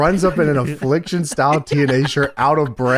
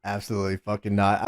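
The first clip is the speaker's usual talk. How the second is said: deadpan; 9 semitones lower, 9 dB quieter than usual. Real, not acted. Deadpan refusal.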